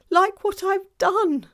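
high, loud, wailing self-reproach; distraught faun. A narrator's reading. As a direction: lament loudly as a guilt-stricken faun.

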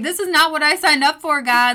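half-joking frustrated protest; exasperated outburst. Retold or imitated. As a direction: throw up your hands at God. Retold complaint to God.

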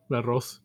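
La Ross as "lah rohs", low and soft; Spanish-style pronunciation. Shown as a demonstration. Friend confirming their name for the store, La Ross as "lah rohs". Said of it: "I always call it".

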